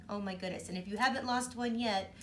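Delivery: ordinary delivery, nothing marked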